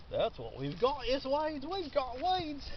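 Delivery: high, drawn-out Monty Python silliness; Monty Python accent. Pretend. Gardener joking about a weedy patch, Monty Python accent. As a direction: do a playful Monty Python accent, pitch climbing.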